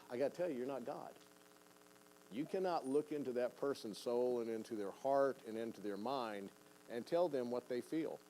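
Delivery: ordinary delivery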